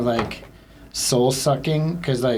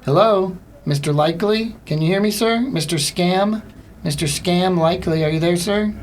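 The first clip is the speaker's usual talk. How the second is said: higher phone-agent voice, checking the line; raised, questioning mock phone call; calling to a caller; 6 semitones higher than usual. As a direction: call out as if nobody is answering. Acts calling out to silent caller.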